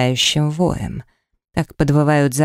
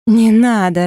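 higher, louder, tearful begging; sobbing plea; 6 semitones higher, 4 dB louder, slower than usual. A narrator's reading. Woman sobbing at the man to stop. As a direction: cry it out in panic. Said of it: "рыдала я".

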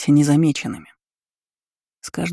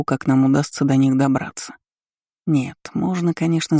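ordinary delivery, nothing marked

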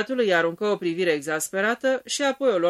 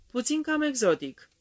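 guarded, higher-pitched understatement; cautious; 7 semitones higher than usual. A narrator's reading. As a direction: say it carefully, lifting the pitch. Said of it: "rosti prevăzător". (prevăzător, cu glas mai înalt)

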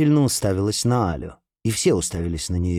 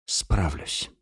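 soft, reluctant squeeze of a word; forced out; 4 semitones lower, 6 dB quieter than usual. Acted. Alya, answering Karim's worry: soft reluctant forced reply. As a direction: barely push it out, shrinking. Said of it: "выдавила Аля".

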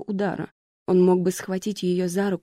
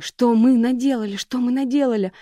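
higher, louder frantic repetition; panicked; 5 semitones higher, 4 dB louder than usual. A narrator's reading. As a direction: spiral into panic. Cannot stop, rising panic.

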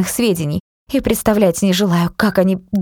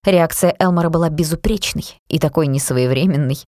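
usual speaking voice

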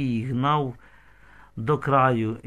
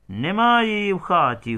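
storyteller's raised-pitch holler; calling out; 6 semitones higher, 3 dB louder than usual. A narrator's reading. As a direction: call it across to the others. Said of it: "гукнув хтось".

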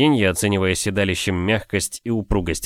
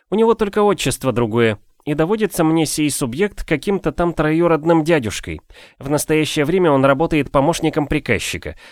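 a bit higher, mock-formal and wry; lightly voiced wry gentleman; 7 semitones higher than usual. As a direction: play the pompous phrasing lightly. Voices Afanasiy's speech.

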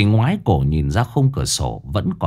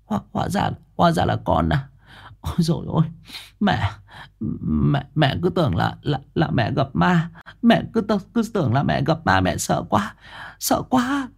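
softer, higher, stammering panting relief; breathless and shaken; 7 semitones higher, 5 dB quieter than usual. Acted. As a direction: gasp it out, still trembling. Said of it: "nói trong hơi thở hổn hển".

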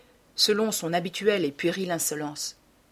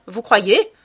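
loud, derisive question; mocking retort; 8 dB louder than usual. Dorian scoffing at the painter's horror, loud and mocking. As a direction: toss it back loudly, on the edge of laughter.

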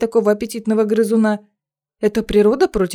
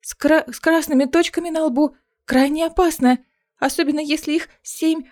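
nervous stammer; 7 semitones higher than usual. Acted. Nervous student at the toad.

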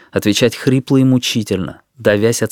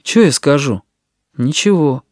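sullen, higher-pitched reply; 4 semitones higher than usual. A narrator's reading. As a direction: shrug the question off gloomily. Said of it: "Он хмуро ответил". (хмурый ответ)

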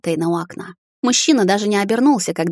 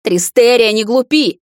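louder, higher shout; 4 semitones higher, 6 dB louder, slower than usual. A narrator's reading. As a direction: yell it after her. Husband shouts after her.

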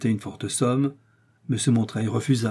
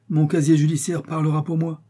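ordinary delivery, nothing marked

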